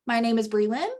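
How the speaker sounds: name said as a question, rising on "Lynn"; upspeak. Shown as a demonstration. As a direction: lift "Lynn" sharply as if asking. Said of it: "Sounds like a question".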